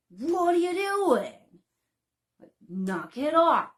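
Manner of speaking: loud, high, slow gruff parent voice; stern father shouting at the kids, slow and loud; gruff dad. Shown as a demonstration. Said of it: "that same tone, that same gruffness".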